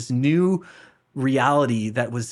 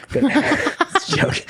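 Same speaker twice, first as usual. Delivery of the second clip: slow, laughing, higher voice; slower than usual. Real, not acted. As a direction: laugh the joke out. Joking about retreat food.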